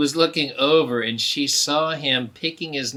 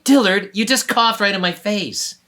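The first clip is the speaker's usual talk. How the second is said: put-on annoyed girl puppet; 5 semitones higher, 6 dB louder than usual. Puppet Stinky complains.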